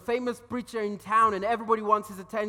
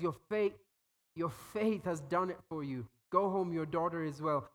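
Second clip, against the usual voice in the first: gentle blessing; 5 semitones lower, 5 dB quieter than usual. Acted. Jesus gently tells woman she's healed.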